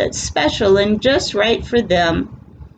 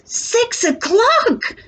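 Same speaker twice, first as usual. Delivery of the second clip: much higher, slow calling out; farmer voice; slower than usual. Acted. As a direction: call the time across the farm. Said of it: "says Farmer Jones".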